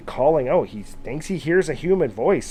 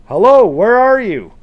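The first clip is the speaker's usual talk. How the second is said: yelling out; 8 semitones higher, 12 dB louder than usual. A narrator's reading. John at the edge of the corn searching, loud yell. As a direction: holler it to someone far off. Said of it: "he yells out again".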